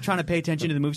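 normal talking voice

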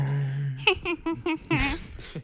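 high, long mock witch cackle; badly faked witch cackle. Co-host providing Halloween sound effects, badly imitated witch laugh. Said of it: "une sorcière très mal imitée".

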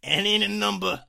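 loud, high hammed-up New York accent. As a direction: belt it out as a cartoonish New York boy. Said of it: "shall I do a New York accent".

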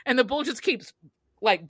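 normal talking voice

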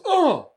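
high, loud short yelp of pain; mock pained yelp. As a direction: imitate a man kneed in the groin. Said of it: "He goes like this".

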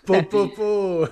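high, loud amused po po po; exclamation amusée. Real, not acted. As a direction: burst out with a laughing po po po. Reacts to the medal dream. (exclamation amusée et aiguë)

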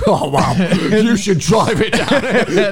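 high, drawn-out teasing imitation; slow, hammed-up amazement; mock awed reaction. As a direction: send up a wowed listener, through laughter. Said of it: "You're like".

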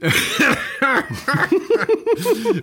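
long high-pitched laugh; real laughing fit at a rude pun. Real, not acted. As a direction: crack up helplessly. Laughing at the joke name.

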